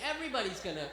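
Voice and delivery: ordinary talk, nothing marked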